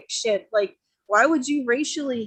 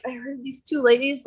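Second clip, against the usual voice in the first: ordinary talk, nothing marked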